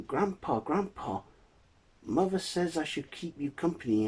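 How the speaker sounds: put-on high-pitched little girl voice; high, eager granddaughter. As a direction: call to grandpa as a small child. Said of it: "The little girl then comes back".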